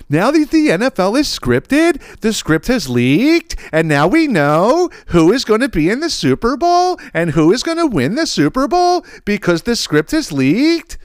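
high, slow, whiny voice with every phrase rising like a question; mock a clueless guy who makes everything a question; mocking uptalk voice. Mocking online NFL conspiracy guys in a high rising whine. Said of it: "And everything's a question".

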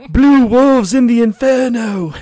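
loud, high, slow surfer-dude Gothic English; mock surfer-voice Dracula, laughing through it; Keanu's Dracula accent. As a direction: ham up a stoned surfer playing a count. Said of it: "like this".

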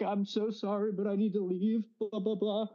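mock-crying apology to the boss; tearful apology. Young employee quitting in tears to his boss, high teary voice.

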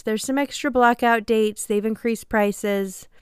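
ordinary talk

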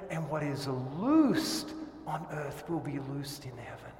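ordinary talk

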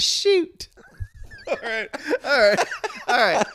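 high-pitched awkward store guy; squeaky oddball coworker voice; high character voice. Acted. Clint character winding down.

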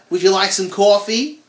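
loud, high New York accent. Shown as a demonstration. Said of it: "I can't do the New York accent".

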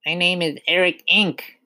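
high-pitched little pupil; boy's voice. A narrator's reading. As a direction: give the answer as a small boy. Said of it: "he said".

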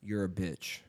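low, soft deadpan jab; hushed hypothetical put-down; quiet test insult. Pretend. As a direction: say it low and under your breath, testing a friend. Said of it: "what if I was like".

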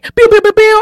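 high-pitched, punchy burst of pews; high, loud pew-pew noises. Retold or imitated. Host acting out people's reaction, high loud pew sounds. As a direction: fire off pews like a toy laser, high and loud. Imitates noise mid-talk.

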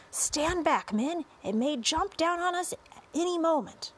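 high, urgent command; yelled warning. A narrator's reading. Chief of police warns his men back, high urgent yell. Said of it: "yelled the chief of police".

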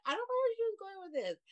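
high-pitched baffled laughter in the voice; disbelieving squeal. Real, not acted.

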